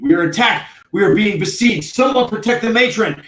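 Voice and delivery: high, loud alarm cry; rally the troops with urgent shouts; screaming. Acted.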